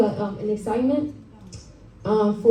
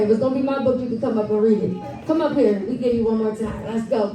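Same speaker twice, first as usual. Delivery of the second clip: ordinary delivery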